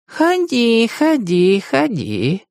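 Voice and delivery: fading echo. Acted. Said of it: "успокоило его эхо".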